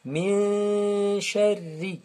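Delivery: "min" held very long in a nasal hum; correct ikhfa. Shown as a demonstration. Teacher gives the right way, long hummed "min". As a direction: draw "min" out slowly into "sharri", n hidden. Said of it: "On dit".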